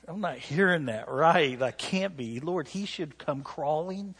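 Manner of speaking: mock self-righteous outrage; indignant protest. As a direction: argue with God that he should come crawling. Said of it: "And I was like".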